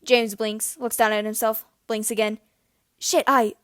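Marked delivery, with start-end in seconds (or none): 3.0-3.6 high flustered exclamation. Acted. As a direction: caught out, blurt it. Caught with shirt inside out.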